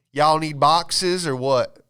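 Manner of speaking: slow, loud Southern manager; drawn-out Southern "y'all need boxes"; Southern accent. Retold or imitated. Manager offers boxes in a slow Southern accent. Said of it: "that accent".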